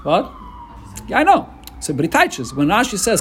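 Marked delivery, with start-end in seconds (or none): 0.1-1.5 mock surprise.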